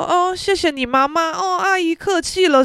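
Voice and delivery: 拔高嗓门装客气; 故意用尖高的客套腔讽刺; 假客气. Pretend. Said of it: "我是要说". (高调假客气的反讽)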